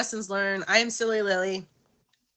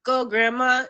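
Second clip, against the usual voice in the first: loud, higher chant cheering Grandma on; goofy cheer; 6 semitones higher, 8 dB louder than usual. Shown as a demonstration. Host cheers for Grandma sitting up, as a loud goofy chant. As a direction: cheer her on like a silly fan. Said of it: "that's the dumb stuff that I do in front of her to make her laugh".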